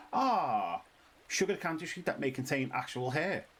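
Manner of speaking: normal talking voice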